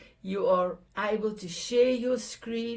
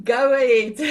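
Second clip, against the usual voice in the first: laughing; 2 semitones higher, 10 dB louder than usual. Real, not acted. Host laughing while handing over.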